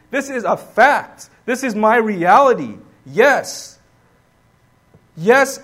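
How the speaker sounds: loud, emphatic preaching, every line pressed home; full-force preaching. Acted. Preacher proclaiming Paul's joy to the congregation, loud and emphatic. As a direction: preach it like it's fact.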